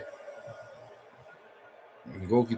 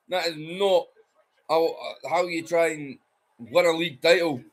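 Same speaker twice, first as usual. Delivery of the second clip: higher, animated pundit voice; live commentary; 9 semitones higher than usual. Acted. Pundit breaking down the Hibs goal for viewers. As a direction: talk it through like a TV commentator.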